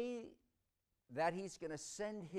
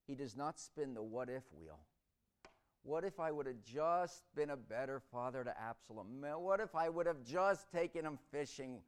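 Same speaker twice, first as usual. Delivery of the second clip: ordinary talk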